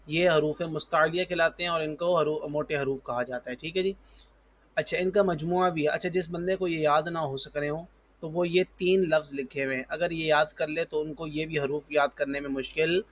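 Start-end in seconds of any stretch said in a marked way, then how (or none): none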